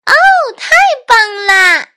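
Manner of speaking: put-on very high, loud girl's cheer; delighted shout. A narrator's reading. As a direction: cheer at full voice like a thrilled kid. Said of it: "希拉大喊". (兴奋地高声大喊)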